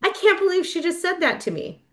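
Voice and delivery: high-pitched retold disbelief; mock indignation. Retold outraged reaction to sponsor's remark.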